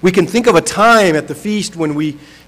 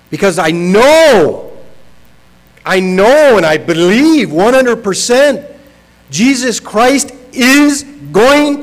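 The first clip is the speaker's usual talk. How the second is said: emphatic, louder and higher sermon delivery; impassioned preaching; 4 semitones higher, 4 dB louder than usual. Acted. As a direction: preach it with rising conviction, punching the key words. Preaches at full force.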